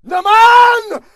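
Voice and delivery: very high, loud disbelieving yell; asked the same question again, exasperated yelling; exasperated shout. Acted. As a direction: scream it like you're trapped in a loop. Exasperated outburst at repeat question.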